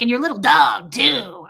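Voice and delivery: loud hammy witch threat; hammed-up Wicked Witch line. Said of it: "She sounded like the Wicked Witch".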